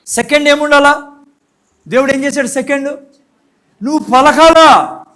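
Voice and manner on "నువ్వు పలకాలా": forceful exhortation. Real, not acted. Preacher exhorts congregation.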